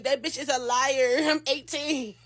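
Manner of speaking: ordinary talk, nothing marked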